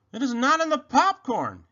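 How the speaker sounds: high, loud, frustrated shout. A narrator's reading. Rabbit dumps the popcorn searching, high frustrated shout. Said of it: "he shouted".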